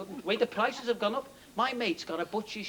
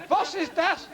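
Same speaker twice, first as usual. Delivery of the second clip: higher, laughing German voice; mock German accent; 7 semitones higher, 4 dB louder, slower than usual. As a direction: play the German tourist, laughing through it. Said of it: "this German".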